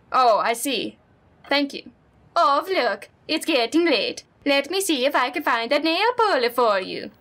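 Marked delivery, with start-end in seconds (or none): 2.4-7.1 higher, kindly Minnesotan neighbor; friendly neighbor lady, lightly accented; Honda-san voice. Acted. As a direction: play the sweet old neighbor.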